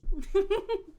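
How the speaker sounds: high, light giggle; short high-pitched giggle; goddess's giggle. Acted. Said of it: "She giggles".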